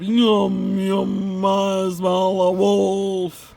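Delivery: loud, slow sing-song bellow; bellowing monster. Acted. The monster Wem bellowing through the trees, loud and slow. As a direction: boom it out like a giant. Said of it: "a deep voice bellowed".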